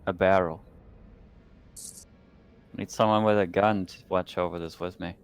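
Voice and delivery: ordinary talk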